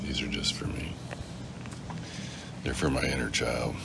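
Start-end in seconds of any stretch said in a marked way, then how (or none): 0.0-0.9 secretive whisper. Real, not acted. Confides cookies are private.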